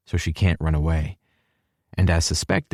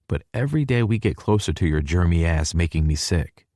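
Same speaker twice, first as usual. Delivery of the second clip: plain reading voice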